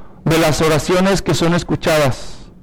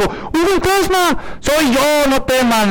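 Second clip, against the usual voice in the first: Jesus on the water; 6 semitones higher, slower than usual. Retold or imitated. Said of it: "le dijo".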